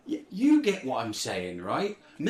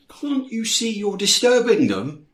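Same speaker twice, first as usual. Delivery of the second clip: raised, higher, indignant; indignant scolding; 6 semitones higher, 8 dB louder than usual. Acted. Gate scolding the police for disturbing them, indignant raised voice.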